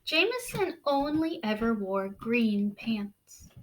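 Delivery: slow, hushed storybook reading; read-aloud narration. A narrator's reading. Teacher reading a picture book to the class, hushed. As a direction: draw young listeners into the story.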